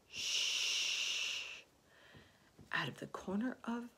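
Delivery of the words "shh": drawn-out "shh"; sustained shushing; long shush. A narrator's reading. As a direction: hush someone with a long hiss of air. Hushing the frightened earthworm.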